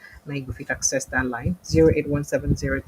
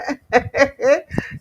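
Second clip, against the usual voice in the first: teasing laugh; 9 semitones higher, 6 dB louder than usual. Real, not acted. Host laughing while teasing.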